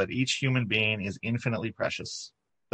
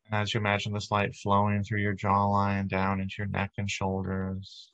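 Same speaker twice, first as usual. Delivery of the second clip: guided meditation. Acted. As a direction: ease the congregation into calm, unhurried. Leads a guided body-light meditation.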